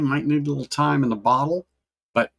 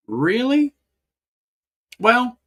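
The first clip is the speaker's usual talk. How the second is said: surprised; 11 semitones higher, 4 dB louder, slower than usual. Real, not acted. Reacting to viewer disliking it.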